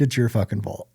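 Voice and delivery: ordinary talk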